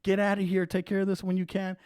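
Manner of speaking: high-pitched imitation of a hurried officer; hurried dismissal. Imagined cop sending driver off to help someone, hurried. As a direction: shoo them off in a high, urgent voice.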